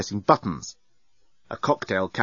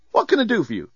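cheery robot voice; 10 semitones higher, faster than usual. Acted. Bellbot asks.

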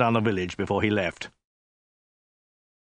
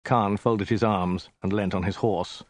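ordinary delivery

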